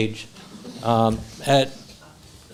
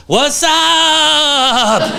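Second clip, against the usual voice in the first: very high, long-held comic holler; Robert's high-energy phone greeting; 12 semitones higher, 4 dB louder than usual. Retold or imitated. Friend answering the phone, imitated exuberant holler. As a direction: stretch the last word out in an excited imitation. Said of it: "the energy when you would call Robert".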